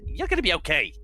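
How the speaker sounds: a gruff man awkwardly kind; a hint of a tender tough guy; Schmitty's voice. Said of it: "He's like".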